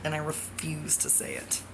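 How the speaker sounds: unvoiced whisper of "I refuse to say it"; whispered refusal. Real, not acted. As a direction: breathe the words with no voice. Whispered then repeated aloud.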